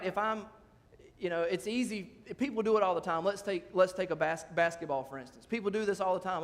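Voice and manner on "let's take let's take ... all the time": normal talking voice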